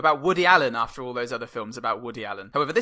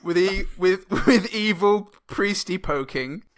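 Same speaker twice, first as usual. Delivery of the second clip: slow, raised, laughing declamation; hammed-up poet, louder and higher; mock poet voice; 6 semitones higher, 5 dB louder, slower than usual. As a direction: declaim it slowly through amusement. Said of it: "You've gotta do it authentically".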